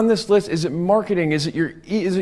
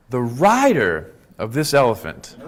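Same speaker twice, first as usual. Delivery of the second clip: ordinary talk, nothing marked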